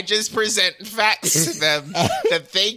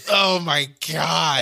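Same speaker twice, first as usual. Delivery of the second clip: shocked exclamation; 3 semitones lower, slower than usual. Real, not acted. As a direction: gasp it out in horror. Reacting to photo.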